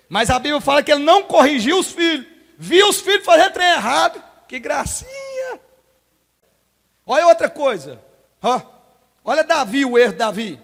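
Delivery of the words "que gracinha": mocking sweet. Mocking a father who indulges sons.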